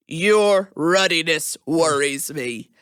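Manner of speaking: very slow, deliberate delivery; slow, measured mock reproach; mock concern. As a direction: space out each word pointedly. Mock scolding of officer.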